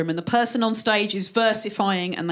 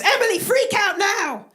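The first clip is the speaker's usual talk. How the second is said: high, frantic command; urgent, high-pitched mock panic; anxiety; 10 semitones higher, 3 dB louder than usual. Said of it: "the anxiety goes".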